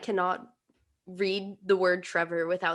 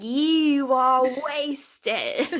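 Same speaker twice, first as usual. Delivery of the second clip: mock Harry voice; 4 semitones higher, much slower than usual. Mocking Harry on the phone.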